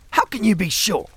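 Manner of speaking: high-pitched barked question. A narrator's reading. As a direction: bark it in sharp disbelief. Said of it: "he barked".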